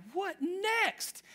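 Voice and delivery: high, fed-up cry of what next; exasperated. Retold or imitated. The boy cries out after his sheep is hurt. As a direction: throw up your hands in exasperation. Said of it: "he's like".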